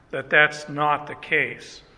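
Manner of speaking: normal talking voice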